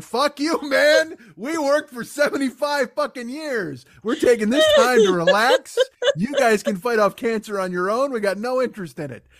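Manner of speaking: put-on a fed-up old worker telling them off; indignant knees. Knees refusing to help the other bones, loud.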